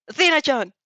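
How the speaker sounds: loud calling shout across the chaos. A narrator's reading. Hawks shouting for Athena amid falling debris, loud call. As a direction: call her name through the dust. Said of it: "You hear Hawks shout".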